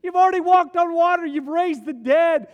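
loud, high, pleading plea to Jesus; loud, high desperate appeal; pleading cry. Acted. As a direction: cry out to him, begging for the impossible. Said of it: "turn to him and say".

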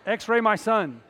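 father losing patience with the nurse; forceful demand. Retold or imitated. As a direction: demand it at full volume. Retold demand to doubting nurse.

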